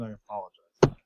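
ordinary talk

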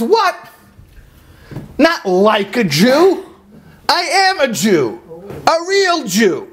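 high, slow indignant cry; mock indignant yell. Indignant friend yelling after the fleeing narrator. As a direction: fire it back high and offended. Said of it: "he's screaming after me".

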